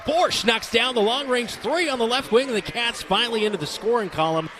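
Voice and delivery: high-pitched play-by-play burst; excited scoring call. Real, not acted. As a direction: call the long-awaited basket with excitement. Calling the team's first basket.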